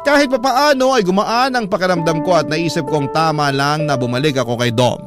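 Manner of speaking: usual speaking voice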